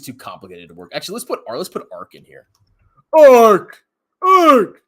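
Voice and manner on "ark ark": loud high yelp of Ark; excited shout of the name; loud call. Acted. Calling out the fund name.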